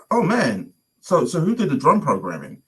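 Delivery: high-pitched admiring curiosity; re-enacted enthusiasm; impressed. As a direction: ask in eager wonder. Admiring question about the music.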